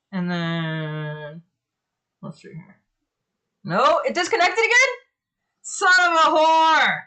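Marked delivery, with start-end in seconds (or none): 3.6-7.0 high, rising disbelief into a loud curse; exasperated cursing. Real, not acted. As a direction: cry out in frustration, stretching the curse. Connection drops again.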